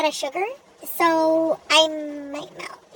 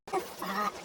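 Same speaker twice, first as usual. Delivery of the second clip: whimpering complaint; 6 semitones lower, 13 dB quieter than usual. Real, not acted. Reacting to the weather.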